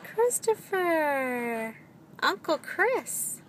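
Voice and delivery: put-on high, slow singsong to a baby; drawn-out high peekaboo voice; playful peekaboo. Playing peekaboo with toddler.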